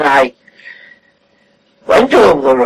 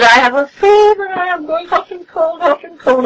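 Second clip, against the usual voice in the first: feverish invalid; 11 semitones higher than usual. Retold or imitated. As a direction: mimic the patient moaning about her fever. Friend describing her fever.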